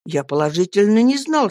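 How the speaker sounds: ordinary delivery, nothing marked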